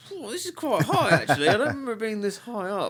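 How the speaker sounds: high, laughing whoa; surprised laugh. Retold or imitated. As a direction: squeal it out amused and startled. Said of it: "I was just like".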